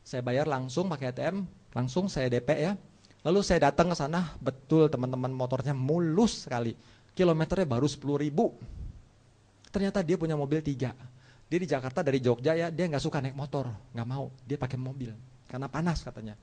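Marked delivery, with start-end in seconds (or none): none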